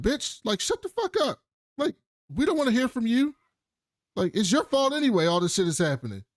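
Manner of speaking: [name] telling Ida off, mock furious; hammed-up nasty snap. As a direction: lash out at her in a shrill mock rage.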